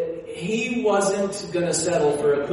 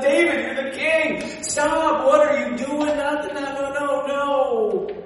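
Michal pleading; 8 semitones higher, slower than usual. Retold or imitated. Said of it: "She's running down and said".

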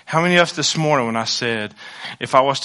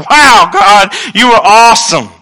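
high, loud cry of wonder to God; fervent preacher's shout of awe; awed shout; 6 semitones higher, 12 dB louder than usual. Retold or imitated. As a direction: shout your praise like you can't contain it. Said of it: "where I'm like".

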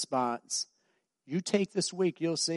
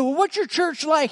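eager stranger; 9 dB louder than usual. Retold or imitated. Stranger voiced in a story.

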